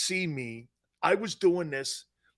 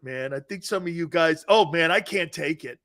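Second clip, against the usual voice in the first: normal talking voice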